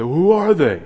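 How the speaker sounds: high, loud demanding question; loud high mock challenge; imagined challenger. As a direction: fire it back like a skeptic. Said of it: "You say".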